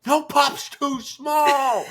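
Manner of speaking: very high, drawn-out hammed-up shout; fan battle cry. Husband shouting the catchphrase every time he watches, high excited yell. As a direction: belt out the catchphrase slowly and high. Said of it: "Every time I'm like".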